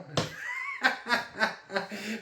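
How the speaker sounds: a high giggle at his own joke; high laugh. Real, not acted.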